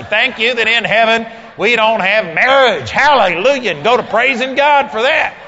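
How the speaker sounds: very loud, exultant sermon shout; full-force preaching. Real, not acted. Preacher models thanking God despite divorce, full-force preaching. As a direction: belt out praise to rouse the congregation.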